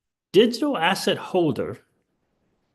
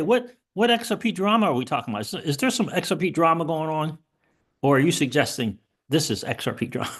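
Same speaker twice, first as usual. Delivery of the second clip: rapid confusion; faster than usual. Real, not acted. Rattles off quick questions.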